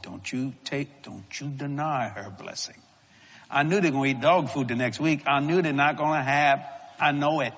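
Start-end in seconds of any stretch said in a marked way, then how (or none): 0.0-2.8 soft, slow inner voice of God; hushed and slow, a solemn warning; the Lord's quiet command. Retold or imitated.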